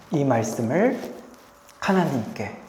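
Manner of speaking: ordinary talk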